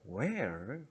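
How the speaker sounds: Where said loud with a rising end. Shown as a demonstration. Teacher shows students the stressed Where, said loud. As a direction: punch the word Where loudly. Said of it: "지금 크게 말해요".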